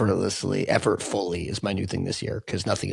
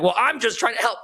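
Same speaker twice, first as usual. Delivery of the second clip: very high, defensive protest; angry; 13 semitones higher than usual. Acted. Positive spinner defending against sad friend, angry and high-pitched. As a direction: whine back in mock indignation. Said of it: "that anger thing".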